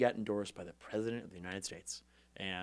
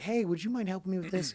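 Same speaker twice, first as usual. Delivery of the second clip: high-pitched, meek ask for a favour; mock timid asking, pitched well up; polite request; 8 semitones higher than usual. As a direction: put on a sweetly pleading little ask. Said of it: "instead of being like".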